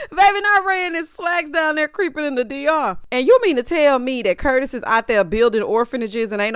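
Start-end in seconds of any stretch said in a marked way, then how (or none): 0.1-3.0 laughing repeat. Real, not acted. Repeats the line, still laughing.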